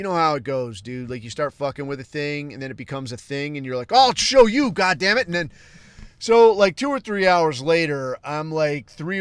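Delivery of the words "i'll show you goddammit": shouting a challenge, high and loud; defiant yell. Retold or imitated. A man picking a fight with a chatbot, yelling it defiantly. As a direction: throw down the gauntlet at the machine.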